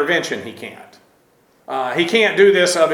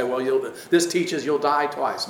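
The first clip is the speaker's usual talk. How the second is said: softer, lower mock of a false teacher; 4 semitones lower, 7 dB quieter than usual. Teacher mimicking pseudo-doctrinal teachers, mildly mocking. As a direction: sneer lightly at the bad doctrine. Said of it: "manipulated by pseudo-doctrinal teachers".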